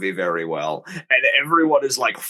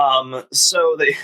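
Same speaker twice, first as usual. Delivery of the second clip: normal talking voice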